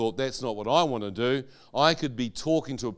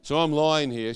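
ordinary talk

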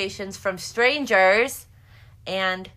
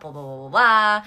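loud blah-blahs, rising out of a low start; singsong dismissive filler; 3 semitones lower, 8 dB louder than usual. Acted. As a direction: gloss over it with a loud string of blahs. Mock babble, brushing past details.

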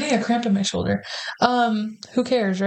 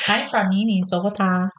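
a light mock Minnesota accent. Mocking dad's voice, light Minnesota accent. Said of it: "That sounds like".

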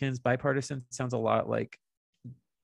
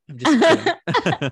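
laughing; 12 semitones higher, 8 dB louder, slower than usual. Real, not acted. Backpedalling after teasing.

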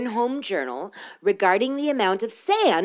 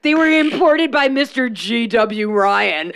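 slow, louder mock-proud reading; sarcastic reading; 5 dB louder than usual. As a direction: read it dripping with sarcasm. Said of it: "I'm gonna read this sarcastically".

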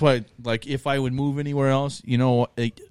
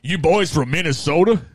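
put-on a higher, louder Minnesota accent as outsiders hear it; mock Minnesota accent; 5 semitones higher, 5 dB louder than usual. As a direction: play up the Minnesota accent like a teasing stranger. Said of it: "I can tell by your accent".

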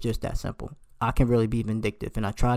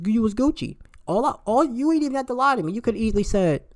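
much higher, exasperated; rising pitch of disbelief; venting at the lying friend; indignant; 10 semitones higher than usual. Real, not acted. Indignant rant, pitch jumps.

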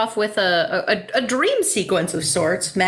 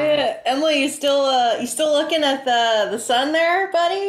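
high playful teasing call; 6 semitones higher than usual. Pretend. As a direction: gently rib your partner, rising. Teasing partner in eclipse box.

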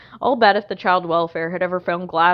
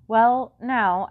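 ordinary delivery, nothing marked

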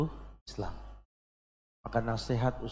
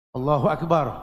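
takbir call; 3 semitones higher, 8 dB louder than usual. Real, not acted. Takbir after conversion.

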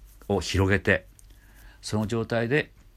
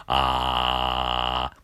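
very slow low creak; low edge voice; slow vocal fry; 7 semitones lower than usual. Shown as a demonstration. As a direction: let it rattle low. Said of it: "こういう練習できて".

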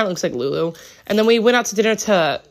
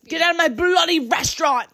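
much higher, shouted mock British; angry London Brit; 10 semitones higher than usual. Restaurant owner throwing a homeless man out, high mock British shout. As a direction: shriek it like a furious London restaurateur. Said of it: "he's like".

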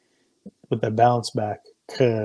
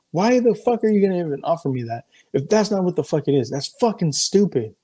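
higher-pitched, exasperated retort; indignant outburst; 6 semitones higher, 3 dB louder than usual. Retold or imitated. Father retelling his reaction to the antibiotics offer, indignant.

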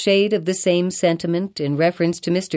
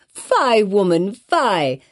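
crying out; 3 semitones higher, much slower than usual. Acted. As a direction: cry out in reproach. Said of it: "cried the old lady".

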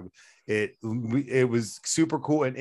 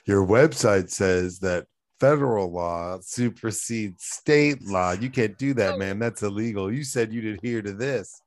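ordinary delivery

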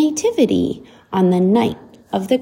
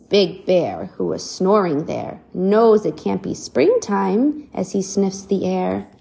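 usual speaking voice

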